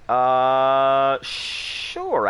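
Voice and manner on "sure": normal talking voice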